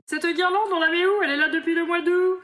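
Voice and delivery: high, loud mock indignation; exasperated comic outburst; mock exasperation.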